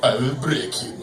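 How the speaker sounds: low, menacing Drago voice; deep, cold barbarian threat; Russian boxer. Retold or imitated. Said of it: "So he's like".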